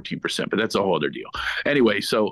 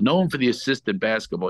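ordinary talk

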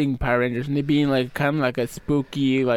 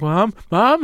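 higher, louder panicked call for mom; 7 semitones higher, 5 dB louder than usual. Retold or imitated. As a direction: yell for mom in the dark. Kid calling mom in blackout.

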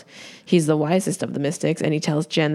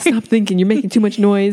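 higher, playfully exasperated; mock annoyance; 4 semitones higher, 4 dB louder than usual. Co-host joining the Skeksis complaint about noise, mock exasperation. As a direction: scold with hammed-up irritation.

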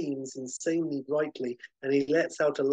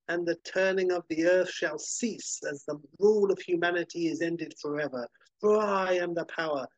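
gloating supervillain; 5 semitones higher than usual. Acted. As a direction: boast like a mad genius who thinks he's won. Villain declares victory.

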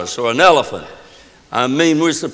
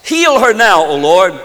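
great cry; 3 semitones higher, 5 dB louder than usual. Acted. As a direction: call it out to God. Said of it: "That's a, a great cry".

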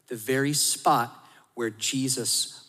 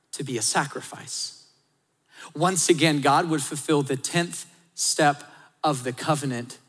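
normal talking voice

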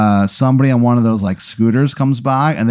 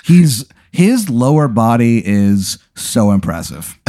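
ordinary talk

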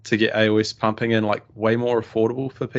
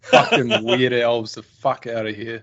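mock outrage; 5 dB louder than usual. Mock outrage at rival's elf list.

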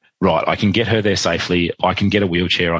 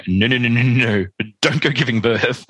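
higher, urgent no-no-no; emphatic protest; 5 semitones higher than usual. Acted. Doctor telling the body not to give birth, emphatic protest.

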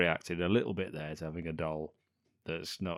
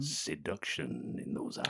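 low, slow, soft croon; mock-seductive; 6 semitones lower, 6 dB quieter than usual. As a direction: croon it slowly, playfully smitten. Playful swooning over actress's eyes.

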